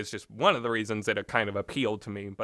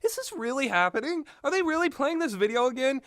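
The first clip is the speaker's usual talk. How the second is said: higher, incredulous retold reaction; exasperated disbelief; 13 semitones higher than usual. Gamer recalling the unskippable video, incredulous and higher. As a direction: ask it as if you can't believe it's replaying. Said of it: "I was just like".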